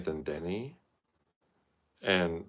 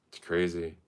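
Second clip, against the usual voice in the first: ordinary talk, nothing marked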